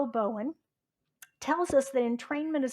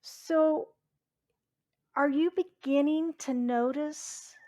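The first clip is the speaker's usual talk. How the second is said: ordinary talk, nothing marked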